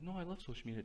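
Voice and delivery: high-pitched mock kid voice; mimicked social-media fan. Voicing kids defending social media, high mimicking tone. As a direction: imitate a keen kid, pitched well up.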